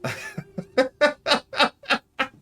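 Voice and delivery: long high-pitched laughing fit; helpless laughter. Real, not acted. Player tickled by the royal match, long high laugh. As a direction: crack up in a high, rapid laugh. Cracking up over the marriage.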